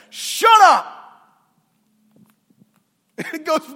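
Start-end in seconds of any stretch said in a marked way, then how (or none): 0.1-0.9 high, loud command to the storm; sharp rebuke. Retold or imitated. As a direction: bark it at the wind and waves. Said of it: "he says".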